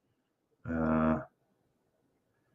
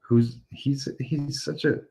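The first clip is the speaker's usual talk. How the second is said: whistling nose; 7 semitones higher than usual. Real, not acted. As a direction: air whistling through the nose. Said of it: "my nose is whistling".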